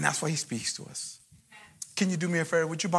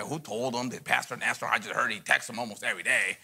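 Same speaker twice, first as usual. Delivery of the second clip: gossiping churchgoer; 6 semitones lower than usual. Retold or imitated. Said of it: "be like".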